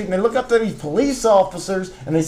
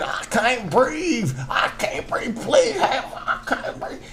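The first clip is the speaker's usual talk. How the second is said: a faint, breathless, choked-up "I can't breathe"; gasping plea; 4 semitones higher, 6 dB quieter, slower than usual. Retold or imitated. Retelling a man choked by police, faint breathless plea. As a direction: mimic a man gasping for air. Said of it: "when he's saying".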